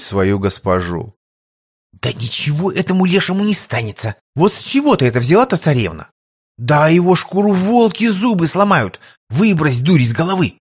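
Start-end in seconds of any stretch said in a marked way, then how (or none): 2.0-10.5 angry hiss. Acted. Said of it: "зло прошипела девушка".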